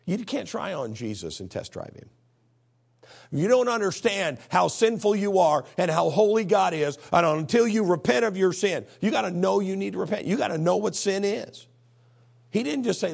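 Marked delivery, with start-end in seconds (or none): 3.3-13.1 loud, raised-pitch exhortation; forceful preaching. Real, not acted. Preacher urging the need to repent, forceful exhortation. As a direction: press each point home at full force.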